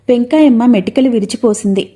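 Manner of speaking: ordinary delivery, nothing marked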